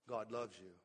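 low and soft, gently reassuring; soft reassurance. Retold or imitated. Pastor answering a troubled member, soft and reassuring. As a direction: tell them softly God loves them.

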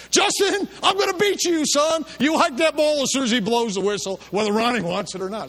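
high, loud yell across the field; father yelling from the sideline. Retold or imitated. Dad yelling at his son from the sideline, loud and high. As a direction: threaten him from the sideline at full voice. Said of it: "the center's father yelled from the side".

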